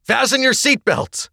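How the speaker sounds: high, loud shouted order; drawn-out, high-pitched emergency shout; yell. A narrator's reading. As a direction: yell an urgent command to the cabin. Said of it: "a crewman yelled".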